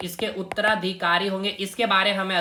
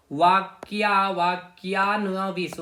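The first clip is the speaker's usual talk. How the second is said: वाक्यान्विश drawn out slowly, syllable by syllable; slow sounding-out; much slower than usual. Shown as a demonstration. Teacher repeating the information minister's title for students, slow sounding-out. Teacher breaks up hard term.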